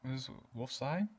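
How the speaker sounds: quiet, baffled murmuring among strangers; hushed, confused bystanders; puzzled townsfolk. Acted. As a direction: murmur it softly, as if asking each other. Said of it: "and are like".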